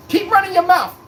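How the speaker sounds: high, loud taunting voice; mock threatening Boston fan. Boston fans warning the streamer, high loud mock threat. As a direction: threaten him in a high squeal.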